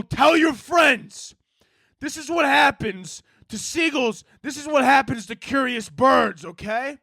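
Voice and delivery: high, hammed-up warning shout; shouting at the sky. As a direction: yell a slow threat up at the birds. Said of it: "I start shouting at the sky".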